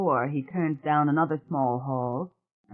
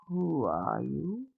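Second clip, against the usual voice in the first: put-on gravelly old woman; 6 dB quieter, much slower than usual. A narrator's reading. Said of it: "The gravel in her voice".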